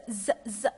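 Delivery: loud teacher demo of voiced Z; short repeated "zz" for Z; voiced z sound. Shown as a demonstration. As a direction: buzz Z, Z as separate sounds. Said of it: "on prononce z".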